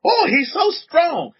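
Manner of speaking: high, slow, admiring cooing; admiring neighbors. Retold or imitated. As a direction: coo over the strong little boy. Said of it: "They say".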